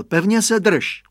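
put-on dad telling the boy to hold on; firm father voice; father's instruction. A narrator's reading. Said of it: "řekl".

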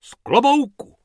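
high, loud correction; correcting. Acted. Bob correcting the hippo, piping up. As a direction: pipe up to set him right. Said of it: "opravil ho Bob".

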